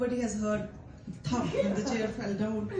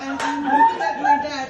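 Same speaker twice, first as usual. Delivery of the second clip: high and loud through laughter; laughing; 8 dB louder than usual. Real, not acted. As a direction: giggle through the line. Laughing during retelling.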